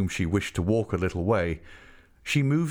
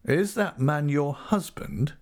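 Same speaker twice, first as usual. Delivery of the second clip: slow, higher-pitched question; mechanical; 7 semitones higher than usual. A narrator's reading. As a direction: ask it mechanically, slowly. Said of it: "he asked mechanically".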